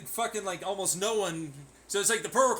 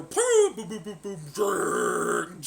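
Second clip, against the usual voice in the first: mock boom sounds; 2 semitones higher, 5 dB louder, much slower than usual. Sound effect of spiral.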